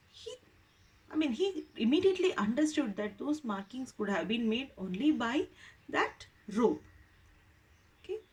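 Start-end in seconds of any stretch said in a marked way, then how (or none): none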